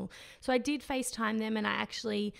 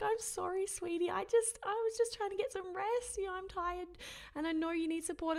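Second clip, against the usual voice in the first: light, higher, softer husband voice; imitating husband; 9 semitones higher, 3 dB quieter than usual. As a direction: lightly mimic a sleepy apologetic husband. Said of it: "he'd be like".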